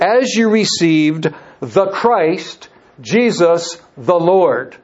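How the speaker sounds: slow reading. Shown as a demonstration. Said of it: "it's literally like this".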